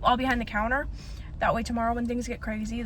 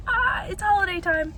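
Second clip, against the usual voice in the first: higher, airy contented sigh; dreamy holiday sigh; 8 semitones higher, 4 dB louder than usual. Retold or imitated. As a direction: sigh it out happily, pitched up. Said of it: "be like".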